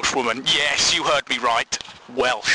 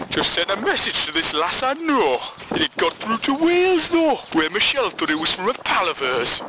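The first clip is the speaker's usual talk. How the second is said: badly faked Geordie accent, pitched up; exaggerated Geordie lilt, leaping high mid-sentence; terrible Gateshead accent; 4 semitones higher than usual. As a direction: put on a hammed-up Tyneside accent. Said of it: "that's a terrible accent".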